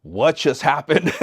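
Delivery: slow, high stunned question; stunned kids. Retold or imitated. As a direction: ask it slowly in disbelief. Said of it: "all the kids were just staring".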